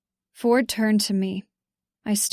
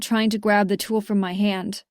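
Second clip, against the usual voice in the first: plain reading voice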